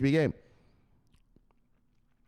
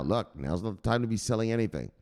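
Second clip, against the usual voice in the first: usual speaking voice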